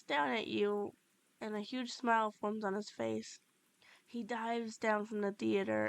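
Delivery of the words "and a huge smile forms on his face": plain reading voice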